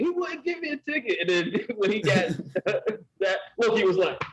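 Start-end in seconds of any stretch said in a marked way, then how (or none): 0.0-1.2 mimicked tattling, pitched way up; high-pitched complaint. Acted complaint quote from film.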